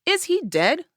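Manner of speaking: sudden high alarmed question; alarmed thought. Retold or imitated. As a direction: jump up high in panic. Panicked thought about ghosting boyfriend.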